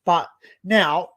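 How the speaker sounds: ordinary delivery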